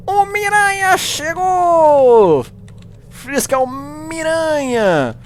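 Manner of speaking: high, slow, stretched-out hype call; announcer shout. Acted. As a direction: belt it like a ring announcer. Announces his own arrival.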